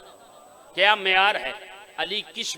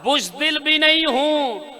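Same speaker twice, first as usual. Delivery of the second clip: slow, raised बुज़दिल भी नहीं हूं with weight on नहीं; stress the line; 5 semitones higher, 4 dB louder than usual. Shown as a demonstration. Preacher repeating the line to a drowsy crowd, stressing नहीं. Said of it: "नहीं तवज्जो किया आपने।".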